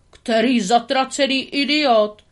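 loud, slow angry bark; furious outburst. Acted. Tramp pokes his head out, furious at the culprit. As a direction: let the anger burst out, then cut off. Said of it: "Tramp rozzuřeně vystrčil hlavu".

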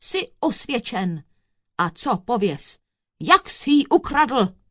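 thundering. A narrator's reading. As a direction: build to a loud demand. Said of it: "zahromoval soudce na kouzelníka".

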